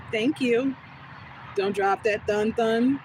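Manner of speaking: usual speaking voice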